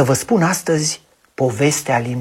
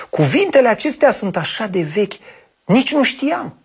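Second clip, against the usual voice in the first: delighted exclamation; 6 semitones higher than usual. Acted. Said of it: "exclamă încântată fata".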